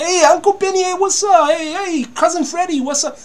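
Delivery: mafia movie cousin. Retold or imitated. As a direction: greet uncle like a cheerful mafia film cousin. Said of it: "Italian mafia movie cousins".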